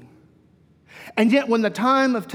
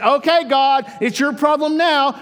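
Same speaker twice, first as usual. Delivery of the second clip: higher, louder careless shrug of a voice; mock flippant; 6 semitones higher, 4 dB louder than usual. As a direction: hand the mess off breezily. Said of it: "We don't look around and say".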